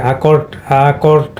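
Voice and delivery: অ্যাকর্ড opened with an "a" (আ) sound; "আ" sound first. Shown as a demonstration. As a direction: say অ্যাকর্ড as "a-KORD". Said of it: "এটা মানে আ হবে".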